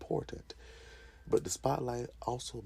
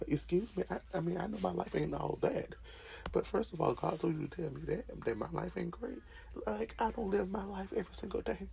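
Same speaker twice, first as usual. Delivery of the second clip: indignant; 10 semitones higher than usual. Pretend. Offended reply to a prophet.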